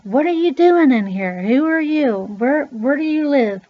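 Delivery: loud, high retold grilling; alarmed questioning. Repairman questions child found inside, alarmed shouting. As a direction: fire shocked questions, imitating the man.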